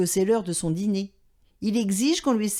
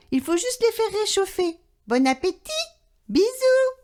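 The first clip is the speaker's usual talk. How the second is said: a lightly voiced wife, much higher; sweet message from the wife, pitched up; wife on the answering machine; 9 semitones higher than usual. A narrator's reading. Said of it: "le répondeur se déclenche".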